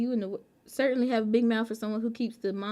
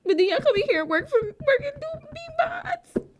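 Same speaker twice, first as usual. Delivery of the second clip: climbs higher and higher in mock outrage; rising mock outrage. Real, not acted. Streamer teasing mods for working, voice climbing to a squeak. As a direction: squeak up to the end.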